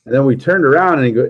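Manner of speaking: ordinary talk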